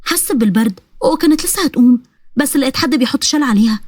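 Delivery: usual speaking voice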